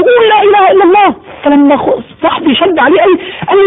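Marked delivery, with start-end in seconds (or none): 0.0-1.1 urgent pleading. Retold or imitated. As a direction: beg him to say it before he dies. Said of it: "يقول له".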